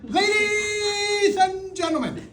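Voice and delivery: big, high, drawn-out ring announcer call. Pretend. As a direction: boom it out slowly to the whole arena. Plays announcer opening an event.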